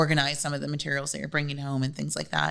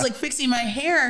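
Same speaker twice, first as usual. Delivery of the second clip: ordinary talk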